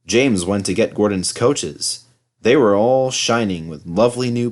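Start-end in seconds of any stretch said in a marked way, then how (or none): none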